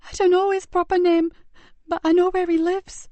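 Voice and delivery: put-on high, boyish, anxious voice; eager to help but scared; frightened boy.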